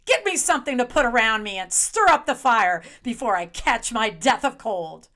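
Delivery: loud, emphatic tirade; outraged wife berating her husband; indignant scolding. Acted. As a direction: give him a furious telling-off. Wife scolds husband after freezing outside.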